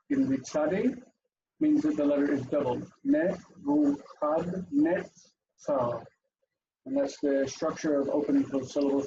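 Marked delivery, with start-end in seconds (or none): 3.0-6.0 very slow "Nebuchadnezzar", Ne-vu-khad-nets-tsar, a pause after each syllable. Shown as a demonstration. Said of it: "divides the name Nebuchadnezzar".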